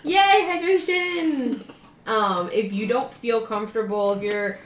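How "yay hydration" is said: high, slow playful cheer; mock cheer. Real, not acted. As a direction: cheer it like a tiny victory. Cheering friend's water sip.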